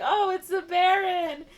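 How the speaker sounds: high, drawn-out cooing awe; crowd's mock fanfare. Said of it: "Everyone's like".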